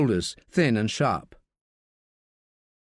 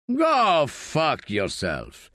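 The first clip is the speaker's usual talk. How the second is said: gruff man voice; 2 semitones higher, much slower than usual. Acted. Piquet snaps at Staff.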